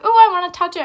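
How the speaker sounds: high, loud mock excitement; imitated eager kids. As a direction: pipe up like an eager schoolkid. Imitates eager kids at zoo show.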